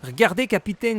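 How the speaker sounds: yelling across the room. Real, not acted. Calling the captain over.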